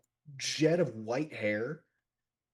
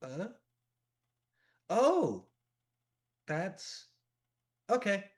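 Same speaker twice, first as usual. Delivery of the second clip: put-on high, surprised kid voice; a child's bright realisation, then acceptance; pitched-up little kid, softening after the "Oh"; child character; 9 semitones higher, slower than usual. Game master voices the surprised child.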